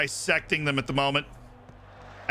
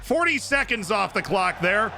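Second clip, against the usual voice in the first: cheer; 7 semitones higher, slower than usual. Real, not acted. Cheering time wasting.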